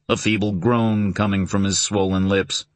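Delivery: usual speaking voice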